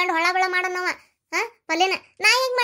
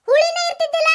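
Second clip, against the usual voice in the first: gushing; 9 semitones higher, 5 dB louder than usual. Acted. Gushing over a neighbour's cooking.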